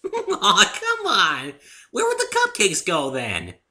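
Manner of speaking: high, loud laughing protest; giggling Pinky. A narrator's reading. Pinky jokes about cupcakes to the narrator, giggling high and loud. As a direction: laugh through the joke. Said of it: "caught in a fit of the giggles".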